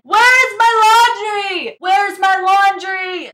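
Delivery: high, loud frantic yell; mock screaming. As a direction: shriek it like she's lost everything. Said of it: "she's walking around the house screaming".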